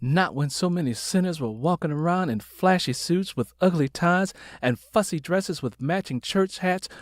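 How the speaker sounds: plain reading voice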